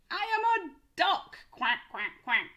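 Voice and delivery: high, loud reveal then quacking; excited duck reveal. Shown as a demonstration. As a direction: announce the duck and quack playfully.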